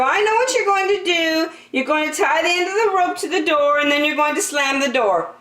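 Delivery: put-on high, fast excited child's voice; eager child. A narrator's reading. Andrew telling the dentist what he'll do, excitedly. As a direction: rattle off the guess eagerly.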